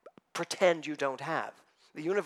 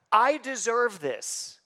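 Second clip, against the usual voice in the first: higher, louder, slow; slow emphatic quote; 8 semitones higher, 8 dB louder, much slower than usual. Retold or imitated. Believer declaring to universe, slow and loud. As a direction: stretch each word out for weight. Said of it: "what you're really saying to the universe".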